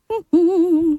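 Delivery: high-pitched string of hums; mocking hmm. Bank account eyeing the would-be spender, mocking hums. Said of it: "your account is looking at you like".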